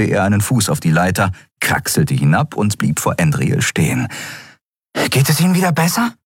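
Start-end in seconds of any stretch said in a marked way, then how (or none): 5.1-6.1 put-on very high, fast boyish voice; young Miko, eager. A narrator's reading. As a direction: rush the caring question out. Boy Miko asks captain. (hohe Jungenstimme, schnell und besorgt)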